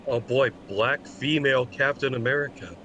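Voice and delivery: ordinary talk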